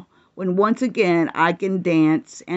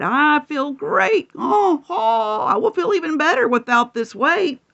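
a little higher, a hint of a donkey; lightly voiced donkey; 7 semitones higher than usual. Donkey telling the others, lightly voiced. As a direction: play the donkey, pleased. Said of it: "Donkey replied".

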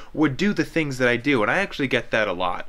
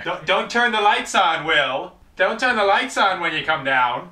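higher, louder call up the stairs; yelling across the room; 6 semitones higher, 4 dB louder than usual. Real, not acted. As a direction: shout up to him, insistent. Calling up to brother.

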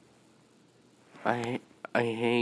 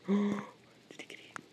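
gasped whisper; 9 semitones higher, 4 dB quieter than usual. Real, not acted. Friend Timothy vanishes.

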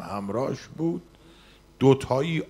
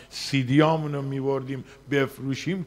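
ordinary talk, nothing marked

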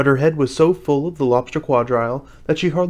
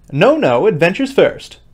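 loud, higher, cutting in; impatient; 5 semitones higher, 7 dB louder than usual. A narrator's reading. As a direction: override them impatiently. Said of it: "in an impatient tone".

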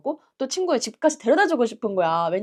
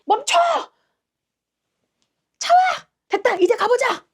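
high, urgent directing shouts; acted traffic-marshal hollering; imitated commanding shouts; 14 semitones higher, 5 dB louder than usual. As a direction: shout commands across the road. Woman directing traffic and driver.

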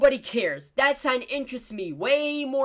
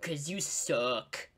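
muttered insult; 9 semitones lower, 9 dB quieter, much slower than usual. Real, not acted. Insulting the talking cat.

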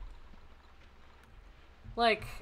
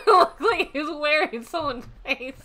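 higher, louder words through laughter; laughing while talking; 4 semitones higher, 5 dB louder than usual. Real, not acted. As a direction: push the words out through giggles. Still cracking up at the glitch.

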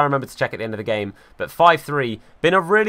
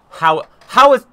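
disbelieving outburst; 11 semitones higher, 9 dB louder than usual. Real, not acted. Another miss, disbelief.

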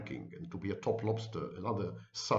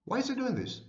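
higher mock bewildered echo of the question; puzzled viewer; 11 semitones higher than usual. Confused viewer asking again why he does it, mock puzzled. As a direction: repeat the baffled follower's question, pitched up. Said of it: "this creates in you a cognitive dissonance".

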